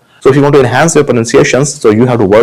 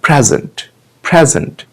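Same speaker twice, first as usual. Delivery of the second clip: "Present" as PREZ-unt, second part falling away; first-syllable stress; 2 semitones higher, 3 dB quieter than usual. Shown as a demonstration. Teacher drilling first-part stress, "present" as PREZ-unt. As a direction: hit PREZ in "present", softly drop the end. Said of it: "पहले वाले part पे हमें stress डालना".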